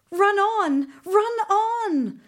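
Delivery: high, loud urgent cry; urgent urging. A narrator's reading. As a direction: urge the horse on, fast.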